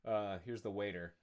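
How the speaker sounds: ordinary delivery, nothing marked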